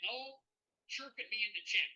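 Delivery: hammed-up Canadian accent. Shown as a demonstration. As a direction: playfully warn them in a Canadian accent. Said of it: "I'm gonna try my best Canadian accent".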